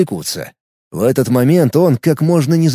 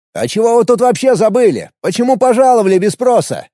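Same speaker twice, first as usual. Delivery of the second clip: mocking retort; 7 semitones higher than usual. A narrator's reading. Alex questions the enemy.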